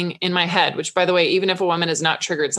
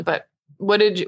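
ordinary talk, nothing marked

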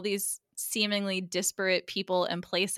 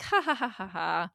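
mock ha-ha laugh, falling in pitch; light, spoken imitation of laughing; imitated laughter. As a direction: fake a carefree group chuckle. Imitates friends laughing together.

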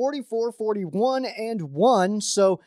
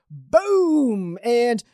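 excited exclamation; 4 semitones higher, 4 dB louder than usual. Real, not acted. Hype reveal of the map.

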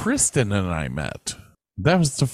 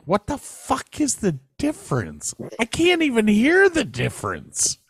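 exasperated mock outburst. Retold annoyed reply to girlfriend.

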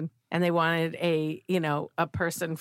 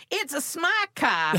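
put-on Boston accent; 7 semitones higher, slower than usual. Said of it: "we do sound like that".

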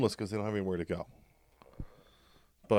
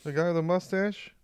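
much higher, louder feigned curiosity; mock innocent; 9 semitones higher, 8 dB louder than usual. Comic feigning ignorance about the solution's origin, mock innocent. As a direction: act loudly clueless, pitched way up.

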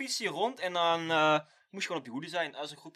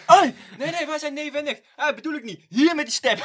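put-on high, louder childish squabble; mock kids; 9 semitones higher, 12 dB louder than usual. Replaying the kids fighting over a scooter, higher mock voice. Friend replays the kid scene.

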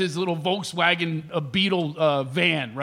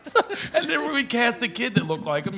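ordinary delivery

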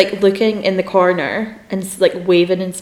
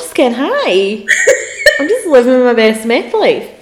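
put-on Glaswegian accent; 8 semitones higher, 4 dB louder than usual. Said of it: "in a thick Glaswegian accent".

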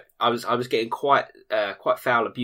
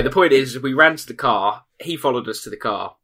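ordinary talk

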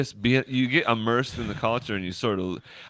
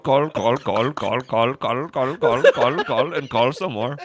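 mock scam boss; 3 semitones higher, 6 dB louder, slower than usual. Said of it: "The bosses are like".

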